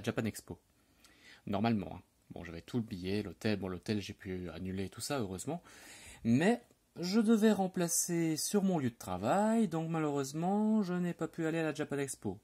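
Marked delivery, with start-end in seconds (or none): 6.2-12.4 theatrically sorry, pitched high; mock lament. Lamenting the missed expo.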